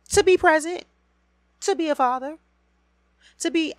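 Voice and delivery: high, loud, punched answer; shouting back at the absent father; outraged answer. Real, not acted. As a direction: fire back each demand. Angry answer to the plea.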